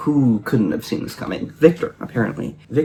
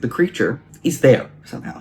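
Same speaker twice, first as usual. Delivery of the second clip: normal talking voice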